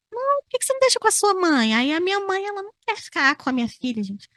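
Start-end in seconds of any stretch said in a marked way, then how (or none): 0.1-1.7 nosy advisor. Retold or imitated. Voicing nosy advice.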